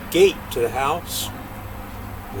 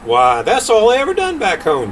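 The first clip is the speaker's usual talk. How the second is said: voiced Jack; 8 semitones higher, 6 dB louder than usual. Jack boasting.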